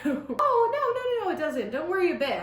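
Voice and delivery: usual speaking voice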